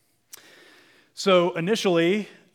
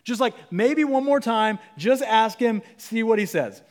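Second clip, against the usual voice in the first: pushy king; 6 semitones higher, 3 dB louder than usual. Retold or imitated. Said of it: "Balak says".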